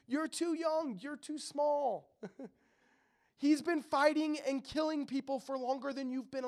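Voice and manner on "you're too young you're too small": a higher, slow, lightly voiced doubting king; dismissive king. King Saul doubting the young shepherd, slow and dismissive. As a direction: talk down to the boy, drawn out. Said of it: "Essentially, he's saying".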